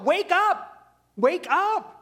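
urging his own soul to wake, high and excited; urgent self-call. Acted. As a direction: rouse your own soul, pitched high. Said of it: "yourself kind of saying to yourself".

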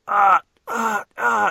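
high, loud mock cries of agony; short acted yelps of pain. Acts Max grabbing his ears.

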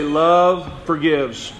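Love forgives, drawn out slowly; slow call for response. Shown as a demonstration. Preacher leads congregation in repeating the phrase, slow call. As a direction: lead the room through Love forgives, slowly. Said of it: "Everybody say".

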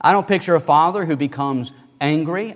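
usual speaking voice

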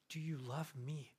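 soft, low, tender asking; hushed and caring; gentle question. Acted. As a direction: ask it quietly, as an offer to restore. Voicing Jesus gently restoring Peter.

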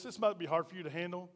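ordinary talk

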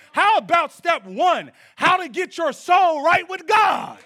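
high, loud pulpit shout building in force; full-force preaching. Real, not acted. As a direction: preach it at the top of your voice.

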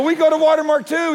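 high and loud, bursting with fun; spring the surprise with glee; gleeful reveal. Retold or imitated. Pastor telling the driver he also attends, gleeful.

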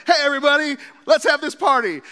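high, loud party greeting burst in the door; excited shout. Retold or imitated. Pastor bursting into a party, calling out loud and high.

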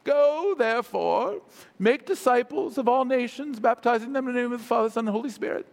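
lilting mock-Shakespearean scripture reading. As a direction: intone it with British theatrical formality. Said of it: "kind of Shakespearean lilt".

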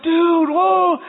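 loud, high startled yelp; cringing yell. Retold or imitated. Dad yelping at his son crushing eggs, loud and high.

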